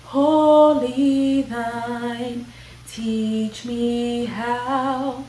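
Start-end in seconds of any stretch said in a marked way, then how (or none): none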